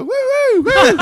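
very high, loud whoops; whooping cheer. Retold or imitated. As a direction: celebrate like rowdy hunters. Hunters cheering, retold.

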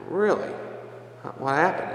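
mock surprise. Mock surprise at monsignor's story.

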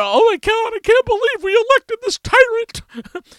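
very high, loud hammed-up dismay; mock outraged people. Podcaster mocking voters shocked by who got elected, shrill mock outrage. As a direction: imitate aghast voters in a squealing falsetto.

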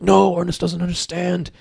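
lightly voiced fretting old lady; old lady warning. Old lady warning nobody listens, urgent. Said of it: "the old lady being like".

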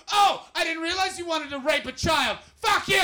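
furious shouting at a Tory voter; ranting shout. Retold or imitated. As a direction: scream it at the guy at the party. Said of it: "And you're like".

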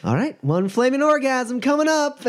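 high loud announcing waitress; loud diner waitress. Acted. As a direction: call the order out to the room. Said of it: "she says it really loud".

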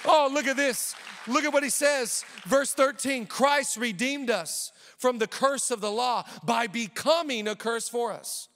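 high, emphatic scripture reading from the pulpit; energetic preacher reading the text; fired-up preaching. Real, not acted. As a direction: preach the verse out, pitch up.

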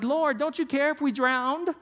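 half-acted alarm from frightened disciples in the boat; panicked disciples. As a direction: play a disciple mildly pleading in the storm. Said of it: "and they're like".

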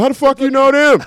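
loud, high, amazed shout from the crowd. Retold or imitated. Birthday show guest imitating the amazed crowd, loud and high. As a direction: belt it out like a stunned fan. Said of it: "everybody in the crowd was like".

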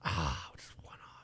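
slow disappointed whisper. Real, not acted. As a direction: breathe it out under the breath. Missed guess by one.

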